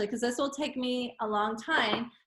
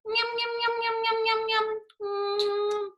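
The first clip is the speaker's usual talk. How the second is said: high playful nom-nom chant; child's high, sing-song munching sounds; eating noises; 11 semitones higher than usual. Acted. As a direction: gobble it down like a happy cartoon. Penguin drinks the water.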